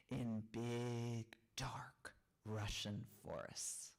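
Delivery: hushed, slow spooky whisper. Acted. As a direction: whisper it like a secret. Spooky forest description.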